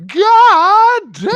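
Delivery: high, loud drawn-out scream; hammed-up shrieked exclamation; Jubilee quote. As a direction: wail both words at full volume. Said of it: "the final line of the entire thing".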